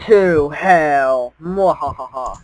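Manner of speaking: loud villain cry, evil laugh; wild unhinged ranting. Pretend. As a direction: ham it up like a cackling villain. Mock villain answer and laugh.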